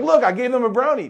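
hammed-up defensive "see, I was generous"; squeaky, loud fake innocence; smug self-justifying. Self-satisfied host after giving the worse piece.

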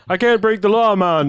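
high, loud Jamaican cabbie voice; Jamaican accent. Retold or imitated. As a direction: play it as a laid-back Jamaican driver. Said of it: "doing his Jamaican accent again".

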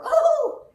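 high, loud squealed "oh!"; delighted "oh". Retold or imitated. Babushka seeing her first American, high delighted squeal. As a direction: let out a high-pitched old-lady squeal of delight. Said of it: "and she says".

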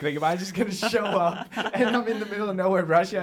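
half-laugh at his own panic; laughing disbelief. Retold or imitated. Panicked inner thought, retold.

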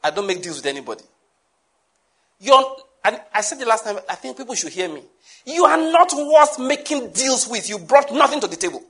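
normal talking voice

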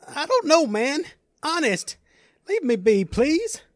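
very high, loud panicked plea; frightened pleading. Acted. Stranger backed against the wall by Led, pleading in fear. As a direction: beg to be let go in a high frightened voice. Cornered man pleads with attacker.

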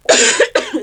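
short high cough; coughing fit. Real, not acted. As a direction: cough sharply into the pause. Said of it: "I'm sorry for coughing".

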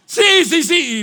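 loud, high firm yes; insistent dad. Retold or imitated. Dad insisting to his son, loud mock firmness. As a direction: push the kid onto the ride.

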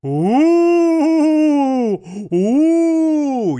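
high, loud ghostly ooh; spooky wail. Acted. Guest haunting the host as the Shadow King, ghostly wail.

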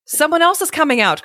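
high, eager storybook call; excited cry. A narrator's reading. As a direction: announce it with rising excitement. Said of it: "cried Shato".

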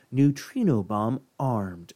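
slow, soft robotic machine voice; like a flat computer warning; bomb announcement. Retold or imitated. A device warns of the armed bomb, in a slow soft machine voice. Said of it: "you hear".

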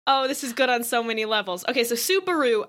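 ordinary talk

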